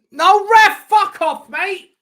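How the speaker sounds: shouting high and loud at the ref; raging at the ref from the sofa; furious shout at the ref. Real, not acted. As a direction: yell it at the referee in outrage. Angry at referee decision.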